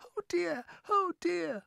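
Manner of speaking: very high fretting voice; frightened murmur. A narrator's reading. Friends huddling for comfort near cloud men, high fretting. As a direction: fret in a high little voice. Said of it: "they murmured, huddling closer together for comfort".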